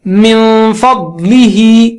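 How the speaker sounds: من فضلہ very slow, with a long nasal hum before ف; drawn-out recitation of من فضلہ with sustained hum; recited ghunna. Shown as a demonstration. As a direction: stretch the n of من through the nose into فضلہ. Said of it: "ناک سے آواز نکالنا ہے".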